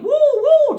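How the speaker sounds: high, loud siren whoops; two loud, high-pitched woos; imitated police siren. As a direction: wail like a cop car siren.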